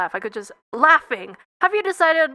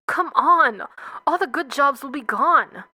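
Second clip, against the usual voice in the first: plain reading voice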